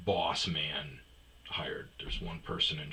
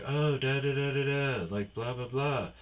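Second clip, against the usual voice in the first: put-on chattering fan girl; 6 semitones higher than usual. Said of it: "She kept, like".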